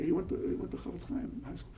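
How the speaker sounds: hushed, hesitant admission; very sheepish. Retold or imitated. Rabbi answering a lady's question about the boy's school, very sheepishly. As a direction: let it out softly, as if embarrassed. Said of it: "like, very sheepishly".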